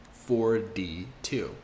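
"Four D two" very slow; slow breakdown. Shown as a demonstration. Teacher slowly breaking down "forty-two". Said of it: "that would be forty-two".

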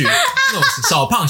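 very high, slow giggly stretch; laughing while describing the chubby roommate. Real, not acted. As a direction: drag it out through laughter.

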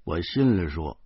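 usual speaking voice

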